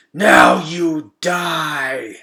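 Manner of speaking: slow, loud, menacing rat scream; rat's scream. A narrator's reading. Wounded rat Shed turning on Gregor, slow savage scream. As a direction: draw out each word as a savage threat. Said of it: "screamed Shed".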